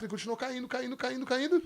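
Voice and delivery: suspenseful hushed rise, as if still dropping; endless falling. Retold or imitated. Acting out an endless fall.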